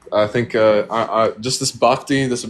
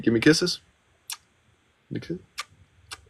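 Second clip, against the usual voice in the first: soft coaxing kiss request; 5 semitones higher, 4 dB quieter, slower than usual. Real, not acted. Owner asking parakeet Mango for kisses, soft baby talk. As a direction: coo at the parakeet, down to a whisper.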